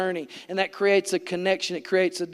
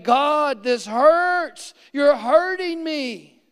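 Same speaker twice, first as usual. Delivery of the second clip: raised, pained plea re-enacted; reliving the pain aloud to God; retold cry to God; 5 semitones higher, 4 dB louder, much slower than usual.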